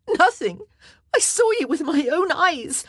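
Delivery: high, loud, disbelieving; indignant outcry. A narrator's reading. Wife rejects husband's excuse, loud and indignant. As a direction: throw his word back at him.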